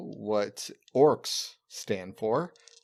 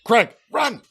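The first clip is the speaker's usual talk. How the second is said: high, loud call; urgent yell; 9 semitones higher, 7 dB louder than usual. Pretend. Goblin yelling at Greg to flee the gas, high and loud. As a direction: shout run at Greg.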